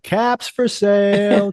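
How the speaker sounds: high, slow sing-song street cry; peddler's call. Retold or imitated. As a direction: call it out like a hat seller. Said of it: "be like".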